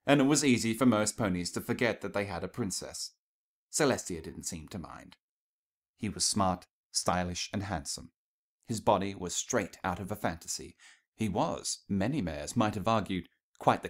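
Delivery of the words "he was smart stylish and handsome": usual speaking voice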